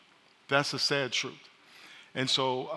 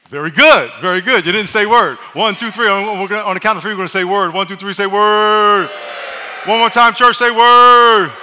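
much louder, higher rallying calls; loud call and response; 6 semitones higher, 15 dB louder than usual. Real, not acted. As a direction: rouse the church to shout back. Pastor drills the congregation to respond.

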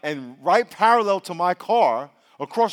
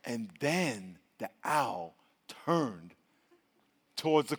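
slow, hushed and lower; suspenseful storytelling; 8 semitones lower, 11 dB quieter, slower than usual. Retold or imitated. Preacher building suspense to the congregation, slow and hushed. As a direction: draw it out for suspense.

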